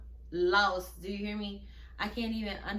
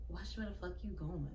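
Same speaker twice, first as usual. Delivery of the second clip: low muttered threat under the breath; quiet whispered warning in a crowded hallway; 3 semitones lower, 9 dB quieter than usual. Retold or imitated. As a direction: hiss it quietly as you pass. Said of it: "And she whispers it".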